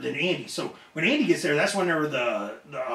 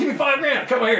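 loud, high-pitched retold outburst; indignant demand; 9 semitones higher, 5 dB louder than usual. Wrestler retelling his demand for five grand, indignant.

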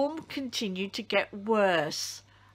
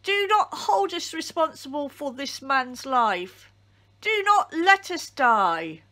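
higher, louder, begging plea; pleading cry; 6 semitones higher, 4 dB louder than usual. A narrator's reading. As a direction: beg God not to let them die. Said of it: "they cried".

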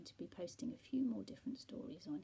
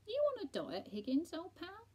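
higher, louder chummy cat; chummy, high-pitched question; teasing pal's voice; 10 semitones higher, 8 dB louder than usual. A narrator's reading. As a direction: jovially pitch it up to an old pal. Cat teasing friend in story.

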